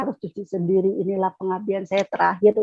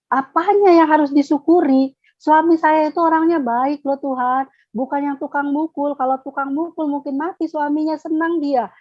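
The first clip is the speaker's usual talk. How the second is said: raised, higher indignant outburst; indignant protest; 6 semitones higher than usual. Retold or imitated. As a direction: argue back at God, stung. Arguing with God, indignant.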